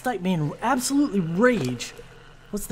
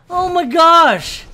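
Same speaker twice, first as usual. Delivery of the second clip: slow, high, loud drawn-out despair; fed up as the crossbow breaks; exasperated groan; 8 semitones higher, 8 dB louder, much slower than usual. Real, not acted. His crossbow breaks mid-fight.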